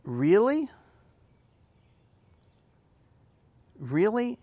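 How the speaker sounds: high, loud disbelieving "really"; incredulous. Real, not acted. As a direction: sound genuinely taken aback. Reacting to the clip's wording.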